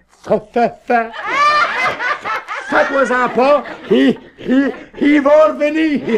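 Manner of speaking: doctor stammers the punchline; stuttering doctor. Acted.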